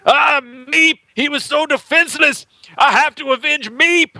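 high, wailing mock lament; hammed-up tragic wail, high and drawn out; mock grieving Evan Peters. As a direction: ham up a grief-stricken avenger. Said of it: "Evan Peters spends, like, four episodes going".

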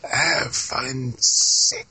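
slow, halting mock attempt to remember; groping for forgotten numbers; unsure recall. Mock failing to recall the code.

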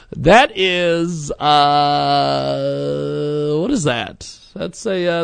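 ordinary talk

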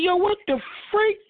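high, strained cry of disbelief; retold disbelief. Host retelling fireworks-night rain, high mock disbelief. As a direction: act out the shock, voice shooting up.

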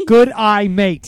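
loud, deliberate 'Good eye, mate'; loud demo. Shown as a demonstration. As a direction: belt out each word of the phrase. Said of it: "you have to say".

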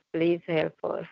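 ordinary talk, nothing marked

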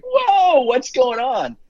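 high-pitched retold gasp of shock; shocked whoa. Parents opening the door on a mess, shocked high whoa. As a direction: jump up in pitch, caught off guard by the mess.

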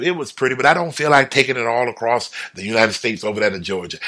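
ordinary talk

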